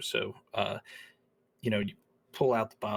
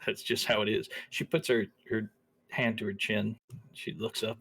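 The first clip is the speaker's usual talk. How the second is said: normal talking voice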